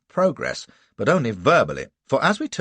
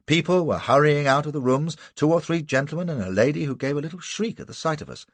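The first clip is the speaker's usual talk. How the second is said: ordinary delivery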